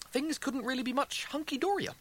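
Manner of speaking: high-pitched, overdone all-is-well voice; forced cheeriness. A narrator's reading. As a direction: insist everything is wonderful. Rimmer pretends all is fine.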